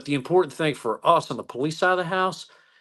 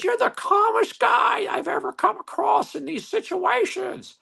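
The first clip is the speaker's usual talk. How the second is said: Jimmy Neutron's teacher; slower than usual. Retold or imitated. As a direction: draw out the compliment in a shrill elderly voice. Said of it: "It sounded like, uh, Jimmy Neutron's teacher".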